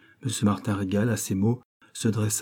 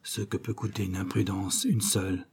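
murmured; 2 semitones lower, 7 dB quieter than usual. A narrator's reading. The shaken banker murmurs his regret, a soft murmur. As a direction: murmur it, almost to himself. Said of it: "murmura-t-il".

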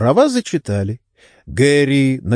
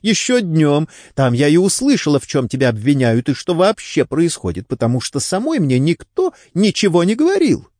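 higher-pitched, mildly indignant explanation; exclaiming; 6 semitones higher than usual. A narrator's reading. Wife complains nobody told her anything, exclaiming. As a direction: keep protesting in a raised pitch. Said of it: "воскликнула Делайн".

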